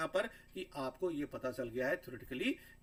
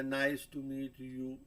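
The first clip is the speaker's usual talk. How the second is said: "Nice to meet you" dragged out very slowly; exaggeratedly slow wrong take; wrong, too slow; much slower than usual. Shown as a demonstration. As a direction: stretch every word, far too slow. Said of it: "तो ये गलत तरीका है".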